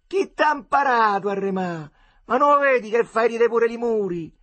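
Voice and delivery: loud, high teasing taunt; mock him from the steps; mocking jeer. Kids mock the rower. (presa in giro ad alta voce)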